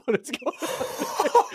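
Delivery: high, slow, drawn-out disbelief; imitating shocked brother. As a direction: stretch it out in mock shock. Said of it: "He's like".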